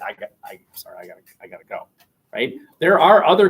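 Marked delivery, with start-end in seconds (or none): 0.1-1.9 low, very soft, rushed excuse; mumbled excuse. Retold or imitated. Teacher mimics someone escaping a conversation, hushed excuse. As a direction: mumble a hurried apology and slip away.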